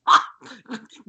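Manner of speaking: short mock-maniacal laugh; unhinged fake cackle; psycho laugh. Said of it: "Emily laughs like a psycho".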